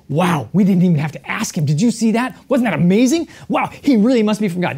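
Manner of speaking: bright, amazed quoting of the disciples. Retold or imitated. Disciples amazed, voiced by preacher.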